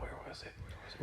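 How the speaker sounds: very soft, voiceless whisper; whispered thinking aloud; whispering to self. Real, not acted. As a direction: mutter it under your breath while trying to remember. Thinking aloud, trying to recall.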